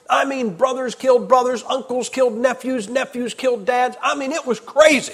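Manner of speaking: a preacher warming up, pitched high; heated preaching. Acted. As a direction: drive the point home, rising. Heated preaching on royal treachery.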